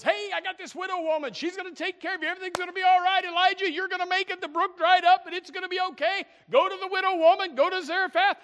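very high, loud, pep-talk voice; upbeat God. Acted. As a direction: cheer him on like a buddy. Acts God cheering Elijah on.

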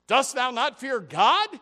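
preacher repeating the thief's rebuke at full force; thundering rebuke. Acted. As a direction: boom the question out.

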